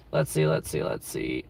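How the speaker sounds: let's see, let's see dropped very low; a goofy deep-voiced mumble while looking around; deep mutter.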